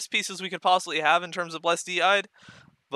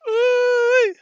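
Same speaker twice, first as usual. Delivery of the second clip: excited squeal; 13 dB louder than usual. Real, not acted. Reacts to rare drop.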